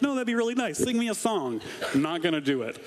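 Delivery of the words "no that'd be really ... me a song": much higher, laughing mock plea; mock eager plea. Pastor teasing members who want the welcome song, high and laughing. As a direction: play the congregant begging for the song. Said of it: "some of you are like".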